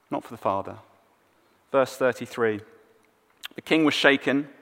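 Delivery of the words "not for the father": much softer, repeating it quietly to let it sink in; soft echo. Shown as a demonstration. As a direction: let the line fall away softly. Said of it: "Well, not for the father".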